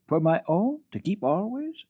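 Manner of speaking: can it really be mine?; excited disbelief. Acted. Girl offered a kitten, asking in excited disbelief. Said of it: "excited and hardly able to believe it".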